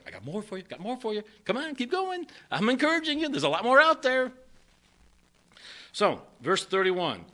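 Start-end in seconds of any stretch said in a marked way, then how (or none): 0.1-4.3 high, eager coaxing voice of God; God encouraging. Retold or imitated. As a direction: cheer them on from above, pitched way up. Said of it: "But he's like".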